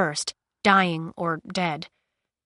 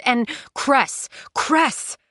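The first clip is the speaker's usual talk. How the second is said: urgent cry of her name, climbing higher; worried shout, mildly raised; alarmed calling out; 4 semitones higher, much slower than usual. A narrator's reading. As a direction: call out in rising alarm. Thorn calling her, breaking into narration.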